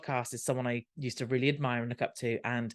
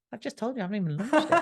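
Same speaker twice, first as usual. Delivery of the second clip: higher, incredulous inner protest; as if the question is absurd, pitched up; exasperated thought; 8 semitones higher, faster than usual. Retold or imitated. Coach repeats his question, higher exasperated inner protest.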